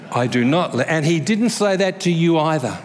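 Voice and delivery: usual speaking voice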